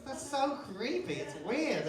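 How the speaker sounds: normal talking voice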